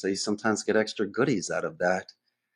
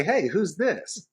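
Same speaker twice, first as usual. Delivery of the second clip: slow and higher, wary "who's this"; suspicious prospect; 8 semitones higher, much slower than usual. Retold or imitated. Prospect questioning the cold caller, slow and wary. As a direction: draw it out like a doubtful prospect.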